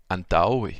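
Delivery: teacher sounding out antaoe, stress on ta. Shown as a demonstration. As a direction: model antaoe for students, landing on ta. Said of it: "l'accent tonique est sur le ta".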